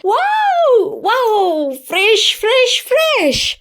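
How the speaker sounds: squealed high, sing-song wow and fresh; gushing delight. Acted. Coo it out, fresh from a hot shower. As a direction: manager Jing gushing, refreshed after her shower.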